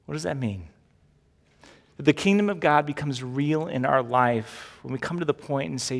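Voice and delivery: normal talking voice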